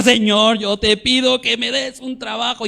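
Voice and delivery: desperate pleading. Shown as a demonstration. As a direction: cry out to God like you're on your knees. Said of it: "y te hincas, lloras y lo ruegas".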